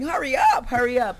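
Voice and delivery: high-pitched, impatient fan plea; eager urging. Acted. Manager as fellow fan wanting a reunion, eager urging. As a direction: urge them on like an excited fan.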